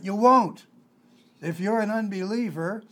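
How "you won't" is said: loud flat contradiction; firm preacher's no; blunt retort. Real, not acted. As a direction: snap it back at them. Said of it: "blunt rebuttal after soothing".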